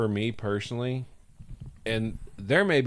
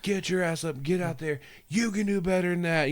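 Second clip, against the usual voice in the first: mock coach yell; 8 semitones higher than usual. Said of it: "coach ... screaming at you".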